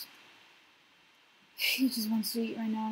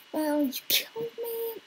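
mock hurt squeak; 11 semitones higher, 6 dB louder than usual. Voicing the killed creature.

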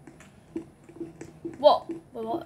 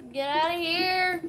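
ordinary talk

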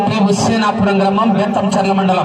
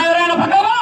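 high, rising call to someone off; 8 semitones higher than usual. Real, not acted. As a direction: call over to a man by the track. Calling out to people at track. (గట్టిగా పిలుపు)

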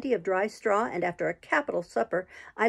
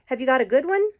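higher, louder call; cried; 4 semitones higher, 5 dB louder, faster than usual. A narrator's reading. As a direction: shout the question across. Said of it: "cried a voice".

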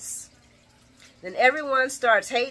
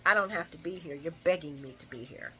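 haughty, lower and softer diva brush-off; 5 semitones lower, 7 dB quieter than usual. Retold or imitated. Vlogger mimicking the show's diva pitch, lightly haughty. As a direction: play it like she's doing them a favour. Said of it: "is [name] attitude".

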